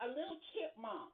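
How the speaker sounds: usual speaking voice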